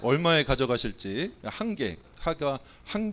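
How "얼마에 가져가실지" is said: ordinary talk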